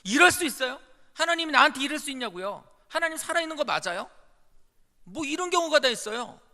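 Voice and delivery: loud, high indignant protest; shouting accusation at God, a bitter complaint; acted complaint to God. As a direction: rail at God as if wronged. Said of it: "원망을 하고 죽어버리라는 거예요".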